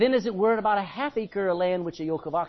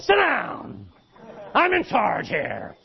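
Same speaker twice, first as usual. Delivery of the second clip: sharp, loud classroom command; commanding teacher shout; stern order; 4 semitones higher, 5 dB louder, much slower than usual. Acted. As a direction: put the unruly kid in his place. Teacher scolding kids, acted.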